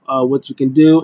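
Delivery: usual speaking voice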